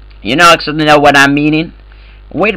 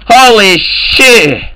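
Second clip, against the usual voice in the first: drawn-out amazed exclaim; 5 semitones higher, much slower than usual. Real, not acted. Gamer reacts in voice chat.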